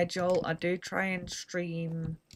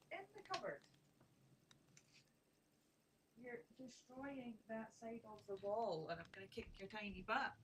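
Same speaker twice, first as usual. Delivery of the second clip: soft pet scolding; 5 semitones higher, 15 dB quieter than usual. Real, not acted. Softly scolding the cat.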